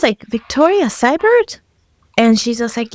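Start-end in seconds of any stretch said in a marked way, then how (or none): 0.3-1.6 high, slow incredulous question; disbelieving name check. Retold or imitated. Patient checks the nurse means her, disbelieving. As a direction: say the name slowly, pitched up in doubt.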